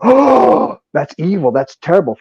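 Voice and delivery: loud high gasped "oh", then milder disapproval; mock shocked reaction. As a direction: imitate people gasping in horror. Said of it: "does something that most people go".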